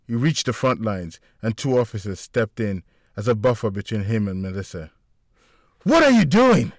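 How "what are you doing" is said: high, loud frantic scream. A narrator's reading. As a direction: force your way through, yelling. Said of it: "he continued to scream".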